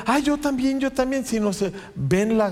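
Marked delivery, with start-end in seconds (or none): 0.1-1.3 a lightly voiced eager churchgoer, pitched higher; half-mocking, grabbing for a miracle too; greedy "me too". Pastor mimics greedy churchgoers, lightly mocking eager higher voice. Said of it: "no se ponen".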